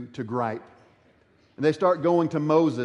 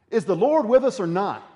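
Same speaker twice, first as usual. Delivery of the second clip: raised, louder doubting question; quoted complaint; 6 semitones higher, 5 dB louder than usual. Retold or imitated. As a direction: voice the people's challenge. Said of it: "here's what they said".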